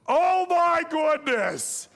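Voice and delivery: high, drawn-out mock shriek; freaking out. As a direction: shriek it loud and slow, hammed-up. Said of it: "she starts freaking out".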